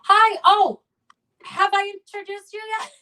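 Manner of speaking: high, loud and cheery hello; bright host greeting. Real, not acted. Host welcoming the next comic, excited. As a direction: greet the newcomer with a burst of delight.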